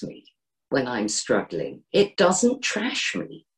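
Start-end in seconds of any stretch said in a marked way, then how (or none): none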